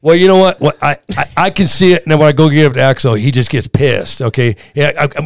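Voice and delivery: ordinary delivery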